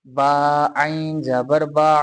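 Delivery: slow Quranic letter spelling. Shown as a demonstration. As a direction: spell each letter slowly, stress बा. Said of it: "हमने कायदा पढ़ा है".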